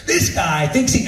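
imitating a heated complaint; high-pitched, hammed-up angry outburst. Bob complains to the director, getting hot under the collar. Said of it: "He's so angry".

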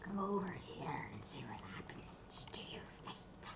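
breathy villain threat; creepy whisper. Pretend. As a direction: lure them in with a sinister whisper.

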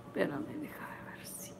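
whispered to herself; whispering. Real, not acted. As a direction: breathe it out as a whisper. Peeling mask, whispering to self.

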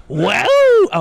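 very high, loud mock howl; loud whoop. Comic answering whether he can be clean, whooping. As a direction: let out a big soaring whoop.

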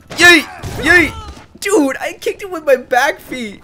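high, loud whoops, then a stretched squeal; giddy high-pitched excitement; gleeful yeeting. Real, not acted. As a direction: whoop with each throw and crow about it. Flinging enemies in game.

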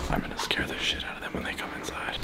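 whispered, very soft plotting; conspiratorial whisper. Real, not acted. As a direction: whisper it so they won't hear you. Plotting a scare in hiding.

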